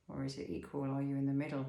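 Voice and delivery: ordinary delivery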